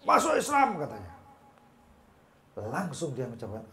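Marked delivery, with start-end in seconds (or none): 0.1-0.8 sudden high, loud shout; sharp raised-voice command; angry outburst. Retold or imitated. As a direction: snap into a shouted order, patience gone. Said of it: "muncul emosinya, dikebrak macam itu".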